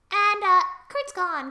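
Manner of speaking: ordinary delivery, nothing marked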